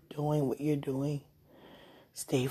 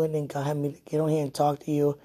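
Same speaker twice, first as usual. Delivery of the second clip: ordinary delivery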